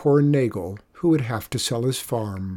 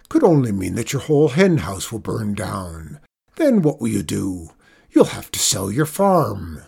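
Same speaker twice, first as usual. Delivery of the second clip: lower, emphatic farmer's voice; gloomy doomsayer; 3 semitones lower, 4 dB louder than usual. A narrator's reading. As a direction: pronounce doom on a worried friend. Zeke predicts disaster to Abel.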